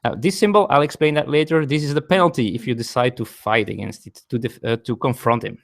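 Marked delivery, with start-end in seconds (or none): none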